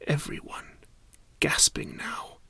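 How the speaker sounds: hushed dramatic whisper. A narrator's reading. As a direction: whisper it like a secret. Narrator hushes dramatic moment.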